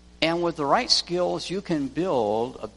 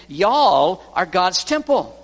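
proclaiming; 3 semitones higher, 7 dB louder than usual. Retold or imitated. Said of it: "He's saying".

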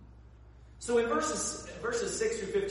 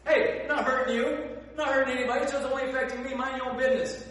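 defensive; 8 semitones higher, 8 dB louder, faster than usual. Retold or imitated.

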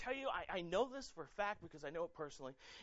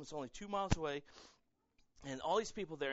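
normal talking voice

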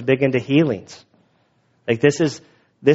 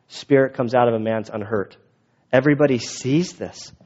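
normal talking voice

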